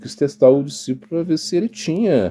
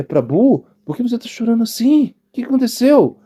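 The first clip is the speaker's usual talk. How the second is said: concerned question; 11 semitones higher than usual. Retold or imitated. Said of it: "Haridas Thakur falou assim".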